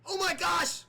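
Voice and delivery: high, loud burst of shock; high, loud oh my gosh as a move lands; excited gasp. Pretend. Shocked commentator reacts to move.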